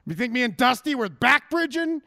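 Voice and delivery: a hint of Flair's bragging scorn; Ric Flair. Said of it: "Ric Flair".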